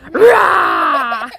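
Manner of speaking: loud mock dinosaur roar; dinosaur rah. Mum roars like a dinosaur for the babies. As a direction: roar playfully like a toy dinosaur. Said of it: "Dinosaur goes".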